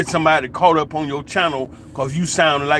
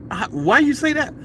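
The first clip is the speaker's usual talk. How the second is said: higher-pitched mock bewilderment; baffled questioning; 9 semitones higher than usual. Answering the preacher's prediction, put-on bafflement. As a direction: act out a puzzled challenge. Acting own baffled reply.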